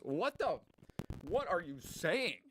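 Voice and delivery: high-pitched, exasperated outburst; exasperated disbelief. Real, not acted. Reacting to the middle hemisphere comment, exasperated. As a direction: demand it slowly, pitch shooting up in bafflement.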